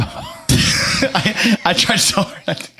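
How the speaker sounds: slow, laughing through words. Real, not acted. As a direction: giggle it out. Laughing at own joke.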